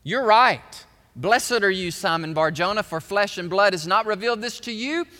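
loud, ringing pulpit delivery of Jesus' words; preaching at full force. Acted. Preacher voicing Jesus blessing Simon Peter, preaching loudly. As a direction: proclaim it like a preacher.

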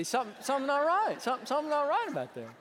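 high-pitched comic grumble; mock indignation. Husband joking about his wife leaving after surgery, mock indignation. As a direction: protest it loudly in playful disbelief.

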